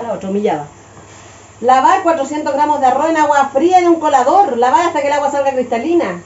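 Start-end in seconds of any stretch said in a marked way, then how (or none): 1.6-6.2 higher, louder instruction read. Acted. Reading the rice box steps to viewers, instruction read.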